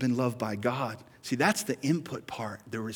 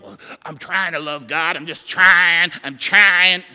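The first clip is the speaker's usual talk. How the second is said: straining to try; 4 semitones higher, 12 dB louder than usual. Retold or imitated. Mimics struggling believer straining.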